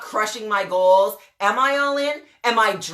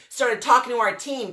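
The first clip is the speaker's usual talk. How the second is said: ordinary delivery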